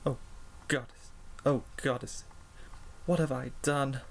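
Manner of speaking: hushed, halting realisation; horrified disbelief. A narrator's reading. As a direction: stammer it softly to yourself, aghast. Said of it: "stared at Pinkie Pie in disbelief".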